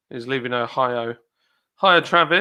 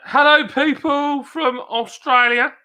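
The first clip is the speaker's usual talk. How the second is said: abartılı selamlama; 12 semitones higher, 5 dB louder, slower than usual. Pretend. Greets Australians in put-on voice.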